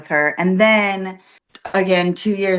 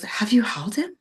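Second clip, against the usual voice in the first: soft whisper, asking carefully; whispered question; 3 semitones higher, 4 dB quieter than usual. Retold or imitated. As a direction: whisper it so only she hears. Sister asks gently aside.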